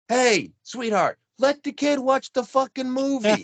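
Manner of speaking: high, loud heckle at the cashier; annoyed yell from the line. Retold or imitated. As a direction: shout it from the back of the queue. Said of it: "the people behind us are going".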